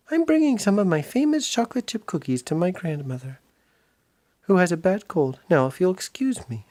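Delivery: put-on prim girl brushing him off politely; little girl voice. Minnie politely dodging Big Pete, high little girl voice.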